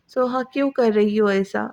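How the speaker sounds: usual speaking voice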